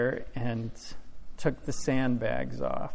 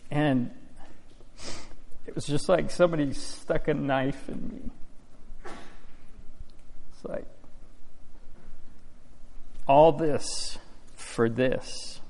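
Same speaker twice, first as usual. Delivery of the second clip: real tears, voice breaking, slowing to a halt; fighting back tears while telling it; crying; 3 dB quieter than usual. Real, not acted. Farmer telling the church his strawberry crop froze, choking up. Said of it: "Sorry, I'm crying".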